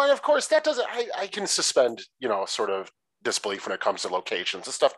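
ordinary talk

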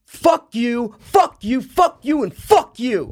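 loud, high imitation of a yelling friend; furious cursing. Friend stops cart to curse golfers, furious.

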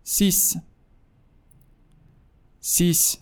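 سیس as in French six. Shown as a demonstration.

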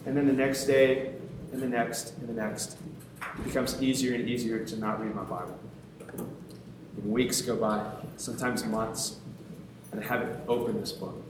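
low, soft, sinking delivery; subdued. Retold or imitated. Preacher confessing weeks without reading, subdued. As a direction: let it sag into low, quiet resignation. Habit slipping away over weeks.

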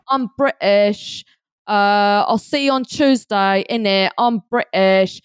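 slow, hammed-up British accent; mock a Brit; fake British accent. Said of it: "American and Australian friends of the show try to do their British accents".

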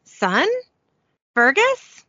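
lightly imitated character cadence, rising high on the name; a hint of a mother's lilting call; Rowena. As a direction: call out questioningly, lifting the second word high. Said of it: "I would say it in her".

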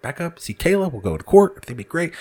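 calming myself down. Retold or imitated. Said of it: "I'm like".

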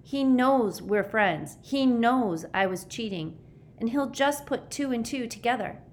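high, agitated worry; anxious outburst in a high voice; panicky distress. A narrator's reading. Panicking boy fears betraying his friends.

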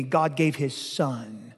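low, slow, each word set apart; slow weighty emphasis. Acted. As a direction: drop your voice and lean on every word.

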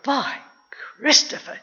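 breath-filled, high exclamation; boy's horrified exclamation; shocked cry. A narrator's reading. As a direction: gasp it in shock. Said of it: "Jack cried".

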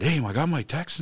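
can't hold it in; excited announcement. Retold or imitated. Husband telling his wife the refund came, excited. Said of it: "so excited".

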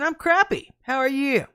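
high-pitched, blunt reply in a retelling; imitating an unfiltered, blunt answer; blunt retort. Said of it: "Just like that. It's exactly what I said".

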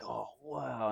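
very soft retold amazement; hushed awe. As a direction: breathe out a quiet, mock-amazed reaction. Acting out the guy's amazed reaction.

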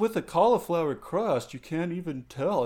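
high, loud hipster mimicry; mock food defender. Hipster defending cauliflower crust, mocked in a high insistent voice. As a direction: push it up high in mocking protest.

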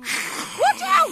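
loud, high alarmed yell; warning shout. Acted. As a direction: shriek the warning at him. Danger warning in combat.

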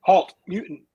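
high, loud attempt at a robot voice. Shown as a demonstration. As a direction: bark it like a robot guard. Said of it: "I can't do a robot voice".

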